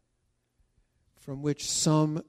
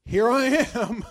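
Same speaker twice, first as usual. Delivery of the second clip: loud answer; 8 semitones higher, 12 dB louder, slower than usual. Retold or imitated. Abraham answers the angel.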